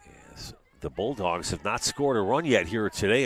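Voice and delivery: usual speaking voice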